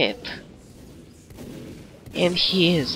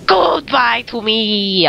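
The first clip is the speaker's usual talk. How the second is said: loud, slow drawn-out cry; big, stretched-out mock farewell; dramatic farewell; 3 semitones higher, 4 dB louder, slower than usual. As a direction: wail it slowly like a hammy death.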